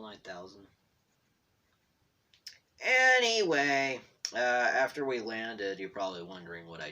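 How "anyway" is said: loud, high, slow "anyway"; loud drawn-out anyway. Real, not acted. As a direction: belt it out to change the subject. Loud reset after gloomy aside.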